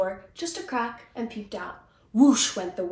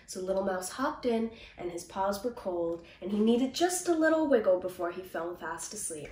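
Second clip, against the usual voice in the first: usual speaking voice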